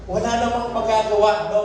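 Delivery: high-pitched, fervent sermon delivery; impassioned preaching. Real, not acted. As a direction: drive the point home like a preacher. Preaches with raised voice.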